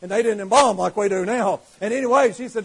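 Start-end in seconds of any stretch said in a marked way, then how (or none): none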